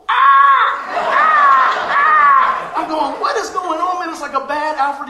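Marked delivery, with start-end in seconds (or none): 0.1-2.7 very high, loud gull shrieks; seagull screeches. Retold or imitated. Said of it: "I see these seagulls going". 3.2-4.1 high, loud bewildered exclamation; retold alarm. Retold alarm at attacking seagulls.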